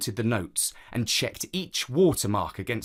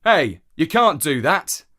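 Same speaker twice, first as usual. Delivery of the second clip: louder, higher stallholder's objection; raised-voice "hey" of protest; exclaimed protest; 4 semitones higher, 7 dB louder than usual. A narrator's reading. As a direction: call out sharply to stop him. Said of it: "exclaimed the stallholder".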